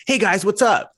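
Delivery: hammed-up American "Hey guys, what's up?" rising high; mock cheery American greeting; American accent. Quoting Tom Holland's upbeat American hello. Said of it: "and I go".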